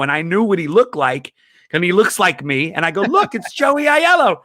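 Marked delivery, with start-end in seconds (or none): 3.0-4.4 much higher, thrilled call-out; excited exclamation. Retold or imitated. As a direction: point out a celebrity to the group. Points out his namesake in photo.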